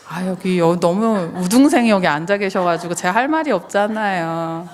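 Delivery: ordinary talk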